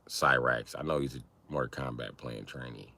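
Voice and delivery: ordinary talk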